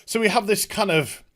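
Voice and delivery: ordinary talk, nothing marked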